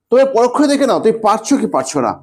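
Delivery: ordinary delivery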